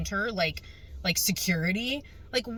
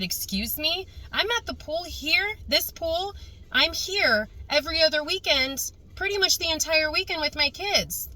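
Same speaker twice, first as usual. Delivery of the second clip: pitched higher, offended and insistent; higher, affronted excuse-me reply; indignant; 8 semitones higher than usual. Retold or imitated. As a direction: push back at the pool lady in disbelief. Retold indignant reply to lady.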